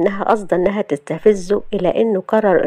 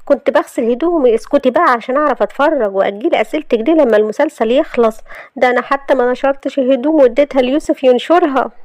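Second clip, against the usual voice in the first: put-on higher, impatient young heroine; chatty Shahd; 6 semitones higher than usual. Shahd brushing off questions about laundry, higher and impatient. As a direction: voice the heroine's brusque chatter, pitched up.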